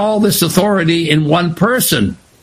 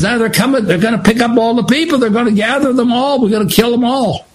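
urgent menace; 8 semitones higher, faster than usual. Acted. Soldiers coming, voiced excitedly.

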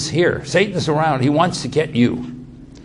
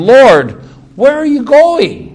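anxious question; 10 semitones higher, 7 dB louder, slower than usual. Retold or imitated. Peter anxiously asks Jesus.

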